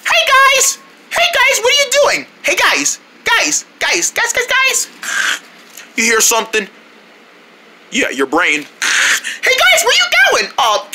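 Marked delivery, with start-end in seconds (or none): 0.1-4.9 very high, insistent calling; pestering call. Acted. Pestering friends for attention. 8.6-10.5 very high-pitched eager call; calling after friends. Acted. Calls after departing friends.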